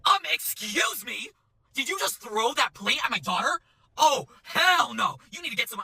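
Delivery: high, drawn-out indignation; slow, high-pitched mock outrage; outraged mom. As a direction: imitate an affronted mother confronting a server. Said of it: "the mom is like".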